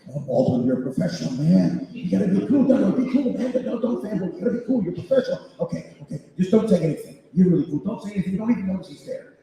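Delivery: nervous fanboy coaching himself; inner monologue. Retold or imitated. Telling himself to act cool around his hero, keyed up. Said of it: "My inner monologue is".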